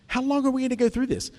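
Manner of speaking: high, quick exasperated question; tense, pointed. Retold or imitated. Imitating Jesus tired of the disciples, tense and pointed. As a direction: fire it off in mock exasperation. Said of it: "kind of pointed right at him".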